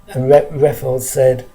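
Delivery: plain reading voice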